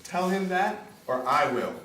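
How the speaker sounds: loud, rising angry refusal; mad. Acted. Rodolfo to Catherine, loud indignant anger. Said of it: "don't get mad".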